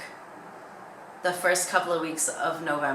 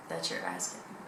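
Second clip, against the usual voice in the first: low muttered punchline; 12 semitones lower, 11 dB quieter than usual. Acted.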